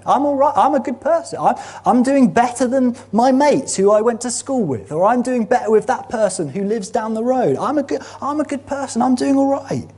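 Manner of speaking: a lightly hammed-up smug voice; smug self-praise. Voicing a smug self-satisfied person.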